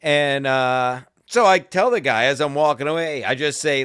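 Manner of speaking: normal talking voice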